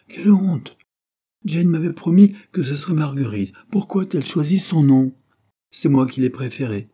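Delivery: put-on high, loud indignant young woman; mock outrage. Marguerite protesting to her brother-in-law, high and loud. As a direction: cry out at the broken promise.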